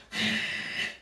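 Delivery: sharp exhale through the nose; forceful exhale. Shown as a demonstration. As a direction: blow the air out hard through the nose. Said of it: "Strongly through your nose".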